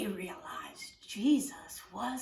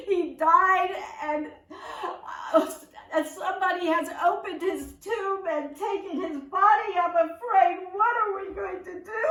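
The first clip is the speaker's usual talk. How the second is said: high, louder mock-crying wail; tearful Mary voice; 6 semitones higher, 5 dB louder than usual. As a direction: act out a distraught, weepy woman. Acting distraught Mary at tomb.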